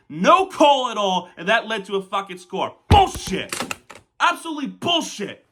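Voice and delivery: real fury building to a scream of bullshit; furious rant. Real, not acted. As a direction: rage at the referee, full voice. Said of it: "now I'm angry".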